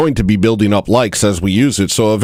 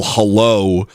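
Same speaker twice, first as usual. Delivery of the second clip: usual speaking voice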